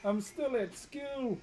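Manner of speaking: high, drawn-out comic protest; mock indignant schoolboy. Streamer plays schoolboy in spoof, mock indignant. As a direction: protest slowly, on the edge of laughter. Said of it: "try and reenact the chat".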